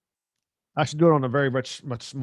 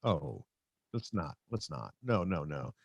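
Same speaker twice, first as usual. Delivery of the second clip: much softer, shrinking back from the crowd; embarrassed refusal; 5 semitones lower, 13 dB quieter, slower than usual. Retold or imitated. At the bar, begging the host not to call him up, hushed. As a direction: wave it off under your breath, embarrassed. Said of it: "And I'm like".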